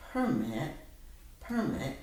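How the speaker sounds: "permit" as a noun, PER-mit each time; stress on first syllable. Shown as a demonstration. Teacher repeating for student, "permit" stressed on PER. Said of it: "the accent was on the first syllable".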